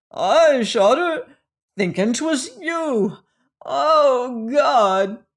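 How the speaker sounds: high, loud anguished outcry; horrified confession. A narrator's reading. Resolution realizing he shot the wrong person, horrified outcry. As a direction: wail the confession in horror.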